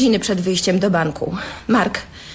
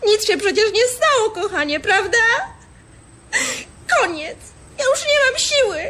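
tearful, raised pitch, breaking into sobs; crying; 8 semitones higher than usual. Acted. Wife, fed up with his gambling, pushes him away crying. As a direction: push him away through tears. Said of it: "O Jezu, nie płacz".

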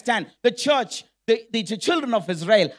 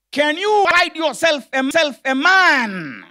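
loud, raised shout with drawn-out last word; mock screaming warrior, stretching MAN; acted giant's bellow; 6 semitones higher, 5 dB louder than usual. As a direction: bellow a challenge across a battlefield. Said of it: "the man was screaming in his bass voice".

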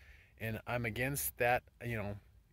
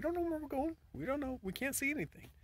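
put-on lost child voice. People lost like children in fog.

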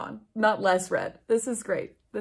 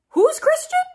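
much higher, louder, outraged question; incredulous squeak; 8 dB louder than usual. Real, not acted. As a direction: shriek the question in disbelief. Reader reacts incredulously.

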